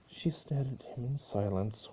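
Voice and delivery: plain reading voice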